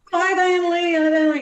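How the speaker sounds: high, bright sing-song hello; sing-song shop greeting; customers' greeting. Retold or imitated. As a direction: call out her name like a cheerful regular. Customers greeting her at store.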